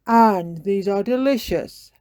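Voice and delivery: eating while talking; mouth full. A narrator's reading. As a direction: talk through a mouthful. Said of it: "added Daddy Pig, tucking in".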